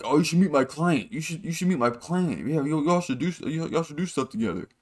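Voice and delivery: mock douchebag networking voice; douchey manager. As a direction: imitate a douchey manager pushing his client. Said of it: "kinda douchey".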